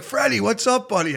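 high, bright hello to a buddy; cheery greeting. Retold or imitated. Greeting the golfer in the clinic, high and excited. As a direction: greet him like an old pal. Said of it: "So I'm like".

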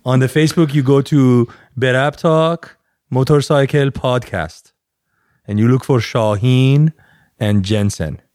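slow mock Iranian accent; measured, put-on Iranian accent. Plugging podcast to listeners, slow mock Iranian accent. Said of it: "the most authentic Iranian accent I've ever heard".

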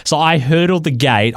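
normal talking voice

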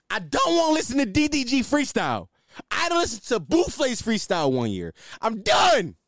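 frustrated yell at the freestyles. Real, not acted. Ranting to viewers about freestyles, yelling. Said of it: "I'm sorry, I just yelled".

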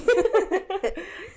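squealing giggle; high laugh. Real, not acted. Laugh after voicing Olive.

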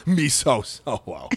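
mock alien creature voice trailing off softly; Jabba the Hutt. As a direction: creature chuckle into broken words. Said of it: "Tom Hanks as Jabba the Hutt".

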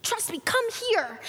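restrained, urgent plea to a son; nervous pleading. Acted. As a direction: beg him over without raising your voice. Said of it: "the father grew more nervous".

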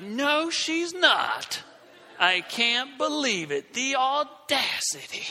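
slow, high, outraged disbelief. Retold or imitated. Watching the classmate raise her hand, slow outraged disbelief. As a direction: drag out each word in shock. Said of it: "I was like".

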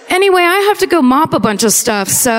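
high, quick, breezy dismissal; breezy brush-off. Retold or imitated. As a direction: toss it off to escape. Mocking janitor brushing off.

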